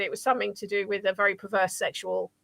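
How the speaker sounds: usual speaking voice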